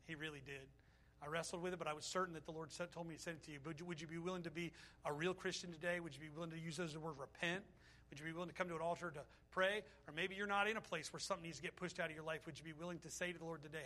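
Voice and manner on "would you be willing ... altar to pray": ordinary talk, nothing marked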